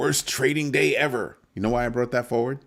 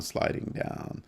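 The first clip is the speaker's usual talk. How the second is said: whisper; 8 dB quieter, slower than usual. Real, not acted. Chart sliding down, repeated softly.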